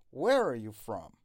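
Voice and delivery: "where" punched loud, "are you" slipped in unstressed; stress on where and from. Shown as a demonstration. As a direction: lean on "where" and "from", glide over "are you".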